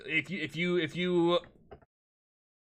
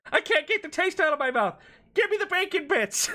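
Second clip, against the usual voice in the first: squeaky high parroting of the line; mocking high voice; 11 semitones higher than usual. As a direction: mimic him in a silly high squeak. Streamer mocks the video line.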